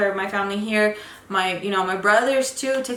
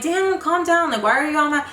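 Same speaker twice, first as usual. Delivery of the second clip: exasperated; 6 semitones higher than usual. Retold or imitated. Arguing with mom on phone.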